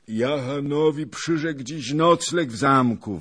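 loud, high wail; howling in despair. A narrator's reading. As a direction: howl it out despairingly. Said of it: "wył w rozpaczy".

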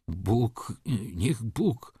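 a poor man too moved to finish his blessing; choking up with gratitude. Acted. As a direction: voice catching on tears of thanks. Poor man thanks, overcome. (łamiący się głos, wzruszony płacz)

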